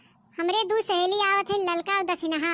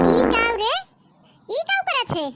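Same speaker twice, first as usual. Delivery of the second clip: outraged yell; 3 semitones higher, 4 dB louder than usual. Acted. Mother-in-law outraged at fart.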